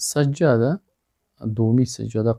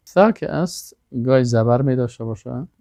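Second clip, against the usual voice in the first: ordinary delivery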